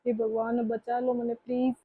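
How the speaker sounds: very soft, low plea to god; quiet prayer. Acted. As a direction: whisper a plea to god for rescue. Bhabhi prays alone, fearful. (बहुत धीमी, नीची आवाज़ में भगवान से गुहार)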